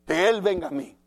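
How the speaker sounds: proud demand, pushed harder on él. Retold or imitated. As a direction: stamp your pride on the word él. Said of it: "Qué mala actitud".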